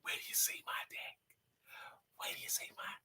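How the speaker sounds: whispered rap line. Retold or imitated. Host quotes the Yin Yang Twins lyric in a whisper. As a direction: breathe the lyric out unvoiced.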